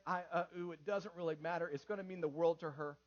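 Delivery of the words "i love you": "I, uh, ooh" in softer vowels only; a child's best try, "I, uh, ooh" without consonants; imitated garbled attempt. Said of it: "then do the best you can".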